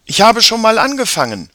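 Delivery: put-on high, loud child's voice; eager girl. A narrator's reading. Sister tells brother she started without him, eager. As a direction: announce it brightly to your brother.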